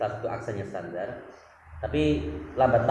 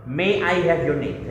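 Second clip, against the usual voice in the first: slow, polite question, louder and higher; polite English; 6 semitones higher, 6 dB louder, slower than usual. Shown as a demonstration. Asking an American teacher's name politely, slow. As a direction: ask it courteously, taking your time. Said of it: "nggak pakai What's your name".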